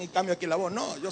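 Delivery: ordinary talk, nothing marked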